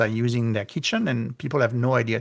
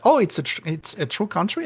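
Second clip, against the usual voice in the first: higher, imitating a stunned question; mock amazement; 7 semitones higher, faster than usual. Imitating people amazed Madagascar really exists, mock surprise. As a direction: play someone astonished the island is real. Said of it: "And they said".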